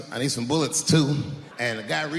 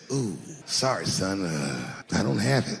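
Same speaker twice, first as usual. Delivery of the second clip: low, soft reluctant father; apologetic dad; 9 semitones lower, 3 dB quieter, slower than usual. Retold or imitated. Dad refusing son dance money, low apology. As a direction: imitate a dad sheepishly letting a child down.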